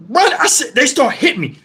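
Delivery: ordinary talk, nothing marked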